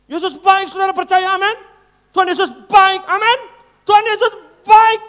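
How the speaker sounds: high, very loud yelled preaching; hammed-up pulpit bellowing; screaming preacher. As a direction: imitate a preacher shouting harder with each line. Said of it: "Ngapain teriak-teriak?".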